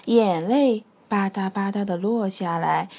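usual speaking voice

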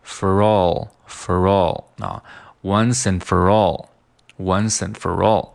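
"once and for all" slowly, "for all" as fer-rawl; linked phrase. Shown as a demonstration. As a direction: link "once and" and roll "for" into "all". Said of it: "那就变成了". (慢速连读)

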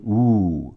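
"呜" held as a long oo; long OO sound. Shown as a demonstration. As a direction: stretch out the vowel "呜". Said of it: "两个O在一起读长音". (把呜读成拖长的长音)

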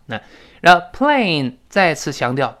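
ordinary talk, nothing marked

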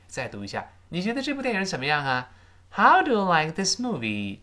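ordinary talk, nothing marked

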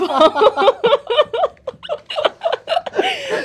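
bright burst of laughter; laughing. Real, not acted. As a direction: laugh out loud, high and free. Hosts amused by listener messages.

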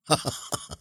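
soft, unhurried little laugh; calm chuckle. A narrator's reading. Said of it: "他淡然的一笑说". (淡然轻笑)

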